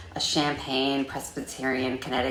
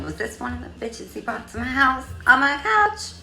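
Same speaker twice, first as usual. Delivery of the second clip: suspicious thought; 9 semitones higher, 4 dB louder than usual. Retold or imitated. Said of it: "I kept thinking".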